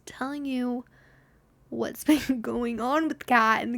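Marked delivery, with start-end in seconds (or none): none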